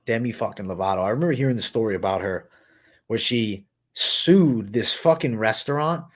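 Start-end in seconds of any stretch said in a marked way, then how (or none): none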